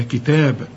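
كتاب with the alif fully stretched; long alif. Shown as a demonstration. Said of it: "هتمدها ألف كاملة".

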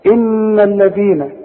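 "إن الذين" slow, noon nasal, "الذين" thin; light letters, ghunna. Shown as a demonstration. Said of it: "الذين كلها مرققة".

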